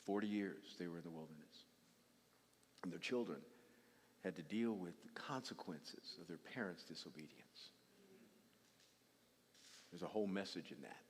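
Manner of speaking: low, soft, sincere appeal; quiet earnest plea. Real, not acted. Preacher begging parents to live right, soft and sincere. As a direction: plead gently with parents.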